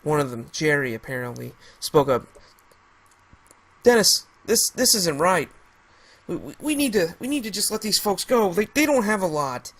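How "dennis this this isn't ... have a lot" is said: high, urgent stammering plea; pleading. Acted. Jerry begging Dennis to spare the family, urgent plea. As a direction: beg him to let them go.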